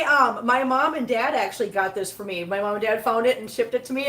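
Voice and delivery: usual speaking voice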